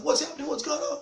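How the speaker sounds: high, loud startled mom voice; hammed-up high-pitched alarm; alarmed mommy. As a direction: wake up in a panic as mommy.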